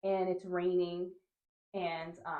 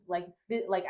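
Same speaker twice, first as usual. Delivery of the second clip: ordinary delivery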